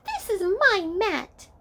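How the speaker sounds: high squeaky rat. Acted. Rat claims the mat.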